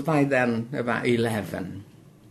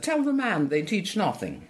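higher, insistent mother's demand; mother insisting; 8 semitones higher than usual. Retold or imitated. Mother urging her daughter to tell the official, insistent. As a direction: press the girl to tell the man. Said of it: "my mother said".

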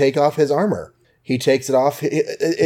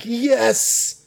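Happy voiced; 12 semitones higher than usual.

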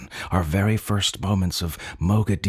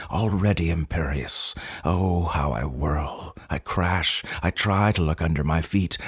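ordinary delivery

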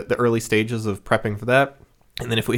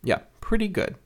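higher, softer, noncommittal quote; lukewarm approval; 10 semitones higher, 5 dB quieter than usual. Retold or imitated. Host quoting their usual reaction to Bendis, mildly approving. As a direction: give a mild, high-pitched verdict. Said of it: "I'm usually like".

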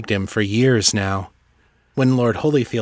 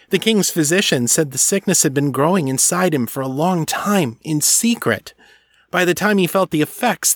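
lightly raised servant voice bearing grim news; a hint of a sly servant, a little higher; sly servant voice, slightly higher; servant's voice; 6 semitones higher than usual.

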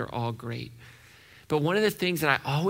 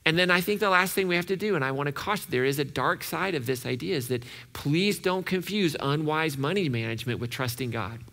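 ordinary delivery

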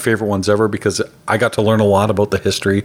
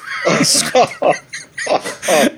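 high laugh; 8 semitones higher than usual. Real, not acted. Laughing at his own story.

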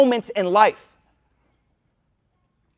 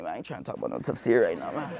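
tired brush-off; 12 semitones lower, 13 dB quieter, much faster than usual. Retold or imitated. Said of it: "I'm like".

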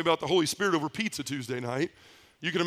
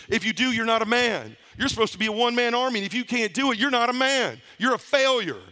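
rising, higher fervent sermon voice; impassioned preaching; 4 semitones higher than usual. Real, not acted. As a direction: preach it at the brothers with growing fire.

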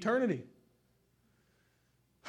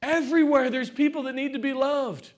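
loud, raised preaching voice; 7 semitones higher, 7 dB louder than usual. Real, not acted. Preacher urging church to love others, impassioned preaching. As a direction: preach it out with rising fervour.

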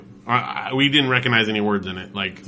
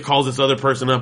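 ordinary talk

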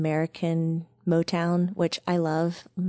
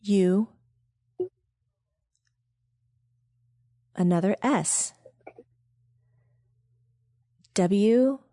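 spelling it out; slower than usual. Shown as a demonstration. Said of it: "Okay, here we go".

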